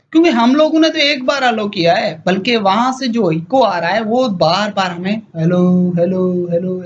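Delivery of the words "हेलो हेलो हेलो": repeated hello dying away; echo. Retold or imitated. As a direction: mimic an echo coming back. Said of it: "ऐसे दे, सुनाई दे रहा है".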